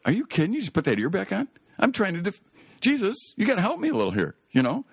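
a frantic disciple who can't believe it, voice way up; exasperated disbelief. Retold or imitated. Peter protesting to Jesus, pitched high in disbelief.

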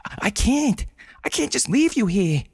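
high distressed protest; breathless desperate plea; desperate gasp. Acted. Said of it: "Brenda gasped".

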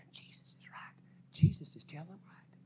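hushed whisper of the sinners; a breathy murmur from the back rows. Retold or imitated. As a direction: whisper it like gossip in the pews.

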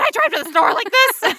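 high, loud mock disbelief; shocked realization. A woman asks herself if she drove pantless, shocked. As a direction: gasp it out in horror. Imagined self with no pants.

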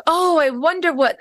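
pitched high, wondering, imitated optimist; upbeat positive man. Stranded passenger greeting the day, upbeat positive imitation. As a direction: imitate a cheerful traveler marvelling. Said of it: "he was just so positive".

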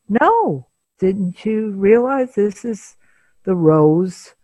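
high, emphatic denial; insistent. Retold or imitated. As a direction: push the lie hard and bright. Husband voiced, insisting on his lie.

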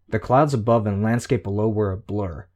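ordinary delivery

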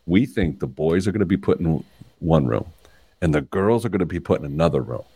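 deep-voiced fourth-grade kid, low impression of a son; dad imitating his son's story voice, pitched low; Ted. Son shares his theory with dad, deep story voice. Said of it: "This is my Ted voice".

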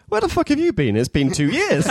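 high-pitched imitation of an exasperated demand; mock indignant outburst. Acting out Doctor's expected outburst.

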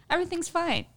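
high, soft feigned innocence; mock innocence. Fibbing about weight at the gynecologist, mock innocence. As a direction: say it small and high, as if nothing happened. Acted cover-up after shaving off five.

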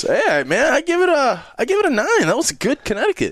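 very high silly voice; goofy high voice. Pretend. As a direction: squeak out the rating. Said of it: "goofy high voice rating cigar".

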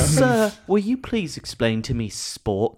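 put-on polite, puzzled British accent; mock-earnest British inquiry; Jon Ronson. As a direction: play a clueless British interviewer. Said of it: "Jon Ronson talks exactly like a guy".

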